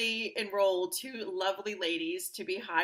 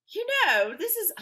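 attempted Australian accent; 13 semitones higher, 7 dB louder than usual. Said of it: "I can't even do her accent".

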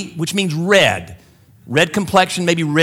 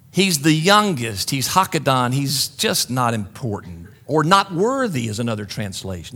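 usual speaking voice

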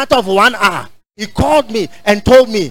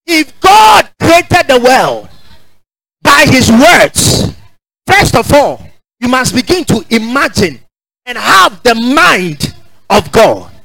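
preaching at full force; 2 semitones higher, slower than usual. Acted. Preacher urging the congregation to imagine, full-force preaching. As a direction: drive it home to the congregation.

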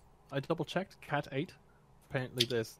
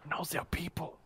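hushed correction; whispering; 5 semitones higher than usual. Acted. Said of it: "why are you whispering".

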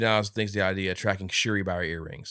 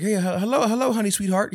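mock phone greeting; 12 semitones higher, 6 dB louder than usual. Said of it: "He says some, something stupid like that".

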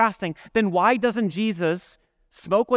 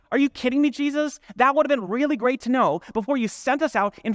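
frustrated outburst; 7 semitones higher than usual. Pretend. Peter complaining to Jesus after the failed exorcism, exasperated. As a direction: vent like a fed-up disciple who was just embarrassed. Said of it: "he probably stood up and was frustrated and said".

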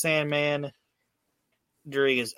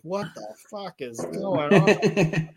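baffled wrestler; 6 semitones higher, slower than usual. Retold or imitated. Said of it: "Steve Williams' character would be like".

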